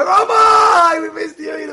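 high, loud calling out; eager greeting. Retold or imitated. Townsfolk calling out to welcome the rabbi home. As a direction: call across the crowd with joy.

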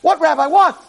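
high, baffled question; mock puzzlement at the rabbi; confused congregant. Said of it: "You'd say".

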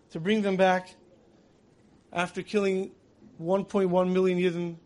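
usual speaking voice

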